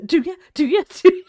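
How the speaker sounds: loud teasing taunt; playful challenge; mock taunt.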